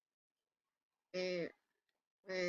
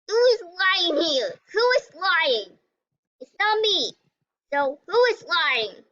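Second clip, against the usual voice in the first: accusing; 6 semitones higher, 5 dB louder than usual. Real, not acted. Accusing players in game.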